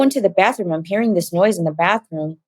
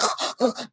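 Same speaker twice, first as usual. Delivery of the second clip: choking gasp; 5 semitones higher, 9 dB quieter than usual. Retold or imitated. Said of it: "He's like".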